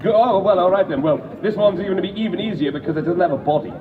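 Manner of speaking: normal talking voice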